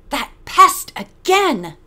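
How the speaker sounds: exasperated exclamation. A narrator's reading. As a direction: exclaim it, irked, stretching each word. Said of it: "he exclaimed".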